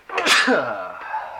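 a loud real sneeze; sneezing. Real, not acted. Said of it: "That sneeze is brought to you".

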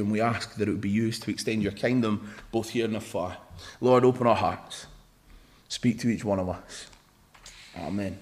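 low, soft, even praying voice; hushed, low-pitched prayer. Real, not acted. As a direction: pray quietly and evenly to God. Opens in prayer.

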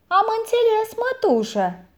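put-on high, eager boy answering; boy answers his aunt, high and dutiful; boy's voice. A narrator's reading. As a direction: pitch up into a young lad.

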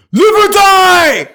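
high, drawn-out battle cry; imitating a loud movie ultimatum; mock karate-movie shout. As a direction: bellow it like a martial-arts threat. Acting karate threat from story.

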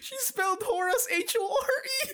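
very high squeal, nearly crying with laughter; helpless laughter. Real, not acted. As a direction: squeak it out as you crack up. Breaks up at the misspelling.

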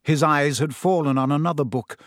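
ordinary delivery, nothing marked

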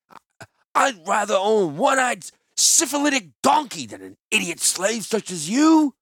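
high, loud, slowing insult; sneering merchant. Acted. The wine seller scorns Gerard loudly.

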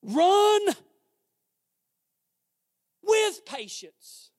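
ringing, punched-out preaching; preaching at full force. Real, not acted. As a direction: call it out to the congregation with big pauses.

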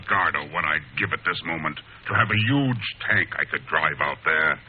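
usual speaking voice

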